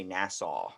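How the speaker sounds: "Nassau" as a Canadian way of saying nasa; "Nassau", the Canadian A, said slowly; Canadian pronunciation. Shown as a demonstration. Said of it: "like, Canada, they say".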